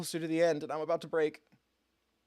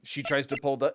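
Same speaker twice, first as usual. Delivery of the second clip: normal talking voice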